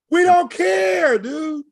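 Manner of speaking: high, loud, drawn-out dismissal; loud fed-up whine; exasperated outburst. Real, not acted. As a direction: push it up high and stretch it out. Repeats fed-up outburst, much higher.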